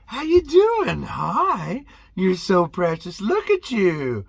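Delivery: a hint of sing-song kid talk; cooing at kids. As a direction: coo at the little ones in the aisle. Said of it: "say hi to those kids".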